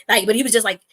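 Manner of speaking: ordinary talk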